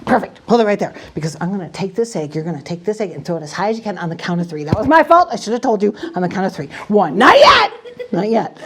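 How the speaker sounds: ordinary talk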